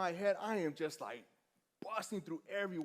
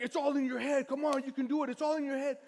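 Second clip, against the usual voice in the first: high, loud urging shout; raised voice, pitched high, egging them on; yelling coach; 8 semitones higher, 7 dB louder than usual. Retold or imitated. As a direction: yell encouragement like a pushy trainer. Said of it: "he's yelling at me".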